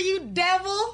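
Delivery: normal talking voice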